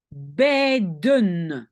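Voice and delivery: "beiden" drawn out in two syllables; vowel demo. Shown as a demonstration. Teacher lets learners hear the E I, drawn-out demo. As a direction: hold the ei of "beiden". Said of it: "c'est E I".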